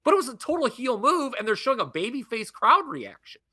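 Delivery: normal talking voice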